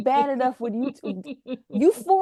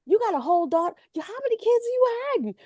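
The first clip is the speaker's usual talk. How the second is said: high, fast, incredulous; mock shock; 11 semitones higher, much faster than usual. Host quotes fans' shock at the hidden kids, high incredulous. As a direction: gasp it in disbelief.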